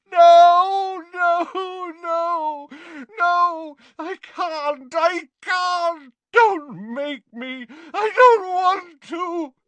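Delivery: very high anguished wailing; tormented cries, swelling loud and drawn out; screaming. A narrator's reading. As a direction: wail and beg in terror. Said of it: "Dumbledore screamed".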